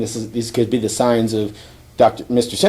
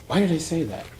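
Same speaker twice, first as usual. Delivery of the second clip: soft, higher-pitched self-questioning; puzzled aside; 6 semitones higher, 8 dB quieter than usual. Retold or imitated. Hero catches himself quipping, puzzled aside. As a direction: ask it quietly to yourself.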